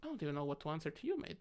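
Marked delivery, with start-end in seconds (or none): none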